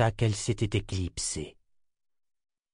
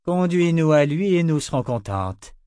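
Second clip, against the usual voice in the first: forced smile; 6 semitones higher, 5 dB louder than usual. A narrator's reading. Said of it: "Elle se força à sourire".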